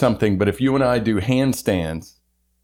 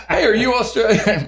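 jokey mock question; 10 semitones higher than usual. Joke question to imagined sheep owner.

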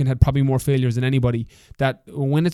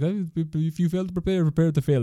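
bad French accent; 4 semitones higher than usual. Quoting his French teacher on exams, in a bad French accent.

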